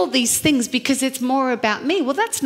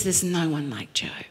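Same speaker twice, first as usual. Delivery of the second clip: lower, soft whisper; whispered God's words; 8 semitones lower, 8 dB quieter than usual. Acted. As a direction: hush it as a sacred quote. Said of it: "he says".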